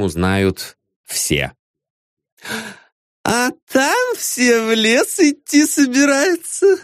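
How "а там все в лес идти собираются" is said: high, slow gossiping voice; high gossipy woman. A narrator's reading. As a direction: announce it like a tipsy diva. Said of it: "сообщила Лариса".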